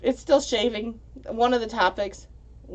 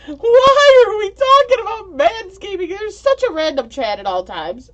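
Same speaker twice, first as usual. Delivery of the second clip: much higher and louder, a disbelieving cry; incredulous outcry; 12 semitones higher, 10 dB louder than usual. Real, not acted. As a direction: shout your disbelief at the chat. Reacting to chat topic.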